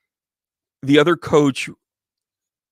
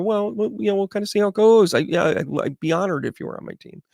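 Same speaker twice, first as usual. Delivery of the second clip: hedging coach; 8 semitones higher, faster than usual. Retold or imitated. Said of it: "And I go".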